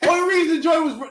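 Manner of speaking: ordinary talk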